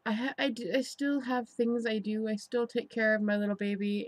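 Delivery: ordinary delivery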